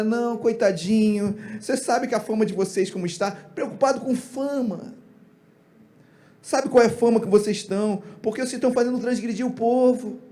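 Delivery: gently wheedle, making fun of the weakness; mock coddling. Imitating Eli fretting over reputation, mock coddling. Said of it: "falido, hipócrita e, e fofo".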